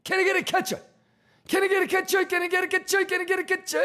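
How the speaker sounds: very high squeaky clown shout; silly clown. Acted. Repeated clown chant.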